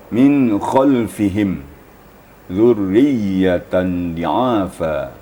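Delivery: "dzurriyyatan di'afa" very slow, doubled letters held; careful tajwid reading; slow recitation. Shown as a demonstration. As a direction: recite each tasydid deliberately. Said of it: "yang sini diperhatikan".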